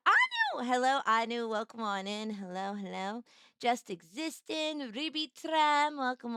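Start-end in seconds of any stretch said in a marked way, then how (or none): 0.1-0.7 excited squeal. Real, not acted. Spotting a viewer, excited squeal. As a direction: squeal the name much higher.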